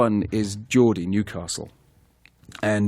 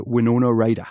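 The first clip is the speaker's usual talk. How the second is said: name said in broad Geordie; Geordie accent. Shown as a demonstration. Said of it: "Is you just say".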